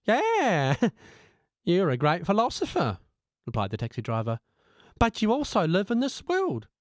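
a cabbie gently mocking, then instructing; amused taxi driver. Driver laughs, then lectures.

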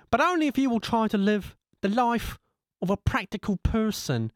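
a cabbie-teacher laying down the rules; taxi driver as guru. Acted. Driver as guru gives advice.

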